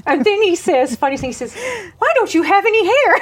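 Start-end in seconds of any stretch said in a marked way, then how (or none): 2.0-3.1 lightly voiced child, higher and louder; curious little boy. A small boy asks the bald woman about her hair, lightly voiced child. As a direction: blurt it out like a nosy three-year-old.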